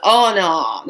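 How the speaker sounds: loud dismayed refusal; acted alarm. Told of angel of death, acts alarmed. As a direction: recoil in mock horror. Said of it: "my eyes got really big".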